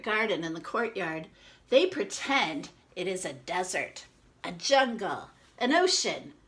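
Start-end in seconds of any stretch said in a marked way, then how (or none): none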